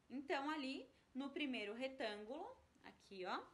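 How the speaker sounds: ordinary talk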